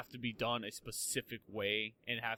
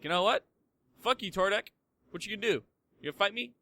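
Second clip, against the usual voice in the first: higher, louder playful challenge; hammed-up taunting, pitched up; mock confrontation; 8 semitones higher, 7 dB louder, faster than usual. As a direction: square up for a pretend fight. Acting out picking a fight.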